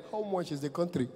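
quiet presidential deadpan; Muhammadu Buhari. Retold or imitated. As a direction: deliver it softly, as a serious question. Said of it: "Then he replied me".

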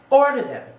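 sudden loud, high insistence on "order them"; emphatic order. Real, not acted. Telling viewers to get spoolies, emphatic.

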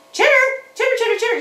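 high, chirpy critter squeak; squeaky puppet chatter. Pretend. Puppet chatters at the children, high and squeaky. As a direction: chatter like a small excited puppet animal.

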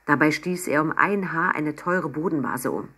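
usual speaking voice

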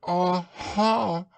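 high, squeaky, nervous aha; anxious Piglet voice. A narrator's reading. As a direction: squeak it out, worried. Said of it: "looking round anxiously".

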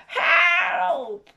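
high desperate cry; witch's cry for help. Acted. Witch cornered by the dragon, high cry for help. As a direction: call out high for rescue. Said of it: "cried the witch".

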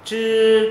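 "Chư" held long on a high level tone, tongue curled up. Shown as a demonstration. Teacher models "Chư" for learners, tongue curled. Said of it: "lưỡi uốn cong lên".

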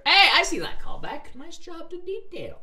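high, loud cheer of approval; excited aside. Real, not acted. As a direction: burst out with high-pitched praise. Reader breaks off to praise callback.

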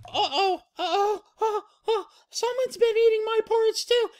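very high squeal building to a loud wail; squeak, then bawl the ending; distressed Baby Bear. Acted. Baby Bear finding his bowl empty, high wailing. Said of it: "Baby Bear goes".

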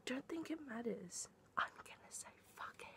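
hushed, quick giving in; defiant whisper. Real, not acted. Deciding to break her rule, in a defiant whisper.

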